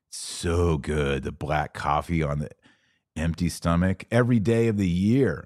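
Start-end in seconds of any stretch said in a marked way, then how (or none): 0.1-1.2 slow, stretched out, relishing each word; drawn-out savoring. Real, not acted. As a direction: linger over it like a sip.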